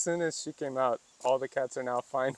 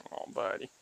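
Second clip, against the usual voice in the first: ordinary talk, nothing marked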